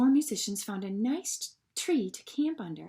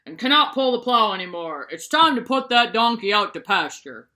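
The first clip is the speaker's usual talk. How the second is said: a hint of a gruff farmer; 7 dB louder than usual. A narrator's reading. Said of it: "His owner continued".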